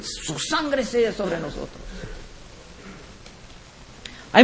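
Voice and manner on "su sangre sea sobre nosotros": much softer, slow and grave; preacher hushing the crowd's self-curse; hushed curse. Retold or imitated. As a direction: drop the voice for the dreadful words. Crowd's terrible curse, retold.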